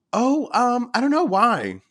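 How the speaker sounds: high, hedging mock reply; acted hesitation. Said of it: "you're like".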